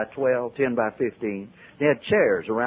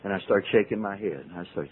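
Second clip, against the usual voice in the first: usual speaking voice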